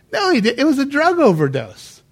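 high, loud protest at dad; insistent retort. Retold or imitated. Correcting dad at the funeral, high loud insistence.

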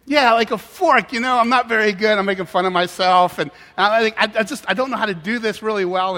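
over-explain loudly to someone who doesn't understand; flustered explaining. Retold or imitated. Retold flustered plea to waitress.

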